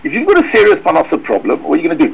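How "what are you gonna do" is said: normal talking voice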